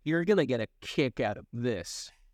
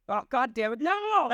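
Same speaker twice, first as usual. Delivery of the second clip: mock outrage; 10 semitones higher than usual. Real, not acted.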